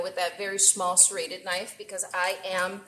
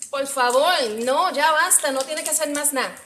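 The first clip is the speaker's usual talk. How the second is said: louder, higher mock scolding in Spanish; playful yell in Spanish; 4 semitones higher, 6 dB louder than usual. Streamer pretend-scolding her friend in Spanish, loud and playful. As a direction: tease her with a half-joking Spanish telling-off. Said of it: "I'm gonna yell at you now in Spanish".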